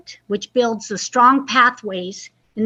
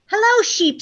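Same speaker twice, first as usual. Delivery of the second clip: much higher, bright duck hello; chirpy duck voice; 9 semitones higher than usual. Acted. Duck greets the sheep, high chirpy voice. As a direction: squeak out a sunny hello as Duck. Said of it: "said Duck".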